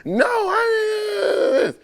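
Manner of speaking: high, whiny mock cry; mock whiny protest. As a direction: whine it like a fake cry. Mimics wife's crying protest.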